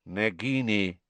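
a touch of cold villain; lightly cold, addressing a pet. A narrator's reading. Master calls his snake, mildly cold. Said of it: "said the cold voice".

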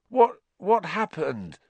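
high, slow, groggy question; groaning. A narrator's reading. Patient waking confused in hospital, groaning. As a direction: groan it out as you come round. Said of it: "he groaned".

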